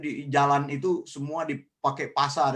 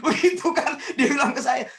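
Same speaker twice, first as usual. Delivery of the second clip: higher voice breaking into laughter; amused, words bubbling into a laugh; 8 semitones higher, 7 dB louder than usual. Real, not acted. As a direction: retell it while cracking up, louder by the end. Amused retelling of the gullible friend.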